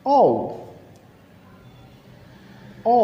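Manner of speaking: British-accented letter "ও". Shown as a demonstration. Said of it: "ব্রিটিশরা এই লেটারটাকে উচ্চারণ করবে". (ব্রিটিশ উচ্চারণে "ও")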